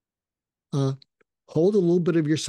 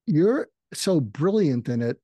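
usual speaking voice

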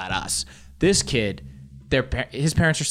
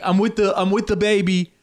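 proud pre-boarding claim; 8 semitones higher, 3 dB louder than usual. Retold or imitated. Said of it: "I'm like".

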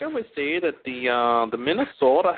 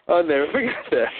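ordinary talk, nothing marked

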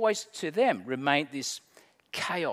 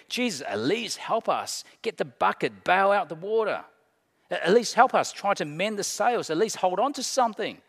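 raised, insistent demands for help; a touch of panic, pitched up; urgent pleading; 5 semitones higher, 4 dB louder than usual. Acted. Disciples urging Jesus to bail water, urgent pleading.

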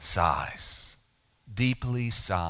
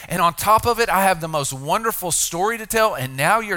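higher, louder pleading protest from the healed man; the healed man begging to be allowed to tell; 5 semitones higher, 4 dB louder than usual. Retold or imitated. Preacher voices healed man's protest.

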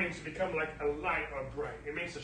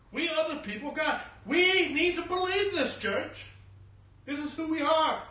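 higher, forceful sermon voice; full-force preaching; 7 semitones higher, 6 dB louder than usual. Real, not acted. Preacher declaring God's covenant to the church, emphatic preaching. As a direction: preach it out with punched key words.

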